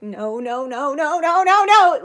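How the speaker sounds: re-enacted helpless panic, climbing in pitch; rising panic. Reliving the car flipping backward.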